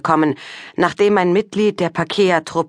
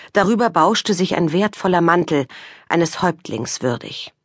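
usual speaking voice

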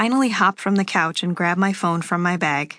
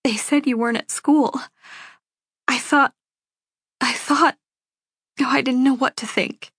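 higher, halting, tearful voice; sniffly, tearful mom; 6 semitones higher than usual. A narrator's reading. Mother to daughter missing from school, tearful and halting. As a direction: falter through it as if holding back tears. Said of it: "She sniffles and says".

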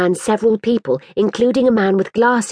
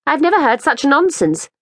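put-on a higher, anxious young mother asking politely; lightly voiced fretful mother; 8 semitones higher than usual. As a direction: plead a little for the ball back. Woman character's voice.

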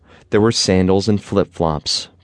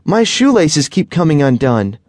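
put-on higher, louder child's complaint; a kid fed up with his laces; frustrated boy; 8 semitones higher, 4 dB louder than usual. A narrator's reading. Boy telling his mom his laces won't stay tied, lightly voiced frustration.

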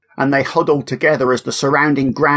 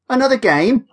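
high eager question; eager child voice; 11 semitones higher than usual. A narrator's reading. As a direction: ask keenly like a kid.

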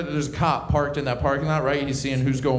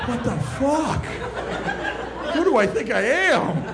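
high, laughing disbelief; mock disbelief; 11 semitones higher, slower than usual. Watching own drunk Snapchat, high laughing disbelief. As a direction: squeal in horror at yourself. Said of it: "You're like".

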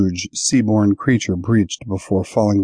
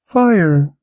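high trilled omen; otherworldly high-pitched trill; trilling creature; 9 semitones higher, 3 dB louder than usual. A narrator's reading. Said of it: "it trilled".

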